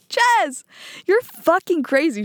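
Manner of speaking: high-pitched, disbelieving outburst; appalled exclamation. Retold or imitated. Telling Jess she has gone too far, appalled. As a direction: start high on the name, appalled. Acts out scolding her reckless friend.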